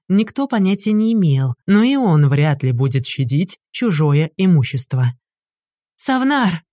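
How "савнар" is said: screeching. A narrator's reading. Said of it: "заверещала Лахджа".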